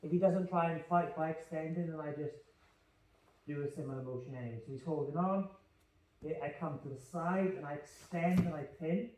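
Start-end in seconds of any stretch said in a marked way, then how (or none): none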